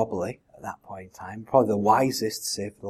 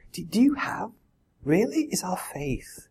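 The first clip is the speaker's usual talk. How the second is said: searching question; 8 semitones higher than usual. Acted. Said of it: "He's asking".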